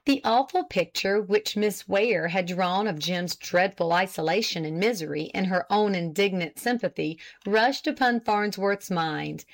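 usual speaking voice

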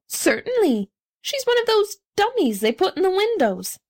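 high-pitched, emphatic declaration; declaring. A narrator's reading. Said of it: "declared the middle-aged man".

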